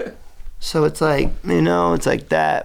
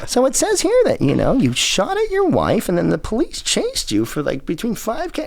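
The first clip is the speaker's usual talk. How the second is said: high, put-on interviewer voice; mock interviewer; 13 semitones higher than usual. Interviewer grilling [name], mock prim voice. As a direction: read the rap sheet with fake politeness. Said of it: "they'd be like".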